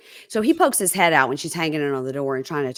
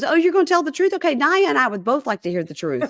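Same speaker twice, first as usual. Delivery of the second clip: higher, louder retold retort; mock challenge; 8 semitones higher, 3 dB louder than usual. Shantae calling out his promise of truth, mock challenge. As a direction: throw the challenge back, pitched up at the start.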